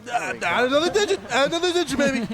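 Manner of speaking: laughing, hyped-up cheer; excited. Real, not acted. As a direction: cheer him on with a grin. Hyping big damage number.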